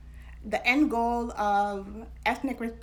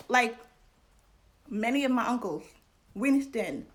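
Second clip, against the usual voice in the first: usual speaking voice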